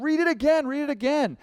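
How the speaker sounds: imitating a brother at wit's end; exasperated insistence.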